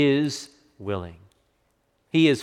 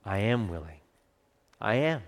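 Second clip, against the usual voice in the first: smiling tenderness; 3 semitones lower, 7 dB quieter than usual. Acted. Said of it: "smiled and said".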